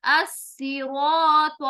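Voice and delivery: अस्सिरात very slow, with a dark heavy r and aa; heavy Arabic as-siraat. Shown as a demonstration. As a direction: stretch अस्सिरात with full tafkheem. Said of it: "रॉ आने की वजह से".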